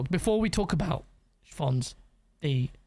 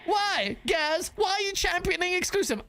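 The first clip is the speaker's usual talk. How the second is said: high-pitched, fake tearful complaint; mock whining; 6 semitones higher than usual. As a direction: whine like a mocked crybaby. Mimicking complaining PC players after wail.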